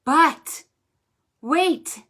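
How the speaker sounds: loud, high but wait; sudden surprise. Shown as a demonstration. Librarian springing the rhyme's twist on children. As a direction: burst in with surprise.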